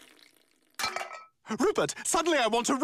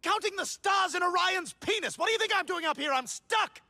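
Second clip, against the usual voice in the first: higher, exasperated shouts; angry yelling; 9 semitones higher than usual. Acted. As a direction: snap at him to get a ladder. Angry from roof.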